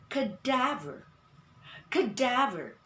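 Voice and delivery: CADAVER as kuh-DAV-er, stress on DAV; second-syllable stress. Shown as a demonstration. Teacher giving the pronunciation of the word, stressed second syllable. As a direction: glide the first syllable of CADAVER, hit the second. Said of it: "the accent of the word is on".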